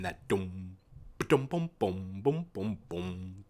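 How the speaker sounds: hummed bass riff; low scatted dum bum bum; bass line hum. Retold or imitated. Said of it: "that bass line".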